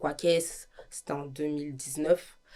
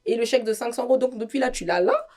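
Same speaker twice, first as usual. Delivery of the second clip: angry retort; 6 semitones higher, 8 dB louder than usual. Retold or imitated. Angry retold quarrel with partner.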